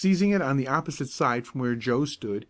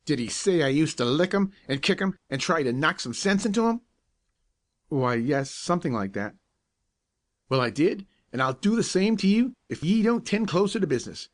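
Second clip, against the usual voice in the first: rising angry threat, higher pitched; bully the boy with rising anger; fierce threat; 4 semitones higher than usual. A narrator's reading. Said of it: "The man's look and manner was so fierce".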